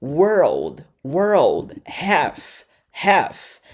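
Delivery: "world" and "half" each said alone, falling, drawn out; word pronunciation drill. Shown as a demonstration. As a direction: model "world", "half" one at a time with gaps. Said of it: "Depois, pras palavras aqui".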